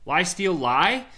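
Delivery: normal talking voice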